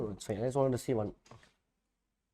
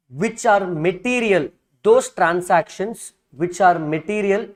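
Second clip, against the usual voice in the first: dictating. Shown as a demonstration. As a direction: read it out phrase by phrase for notes. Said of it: "let me dictate the same thing".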